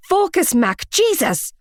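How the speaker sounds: high, pushed exasperation; exasperated shout. A narrator's reading. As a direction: snap at him to focus. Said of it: "I practically shouted".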